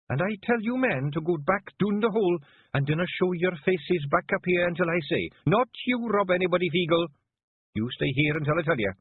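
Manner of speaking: the Kelder ordering her men down the hole; hard, cold command. A narrator's reading. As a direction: cut the air like a dagger of ice. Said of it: "hard, cold, sharp, cutting the air".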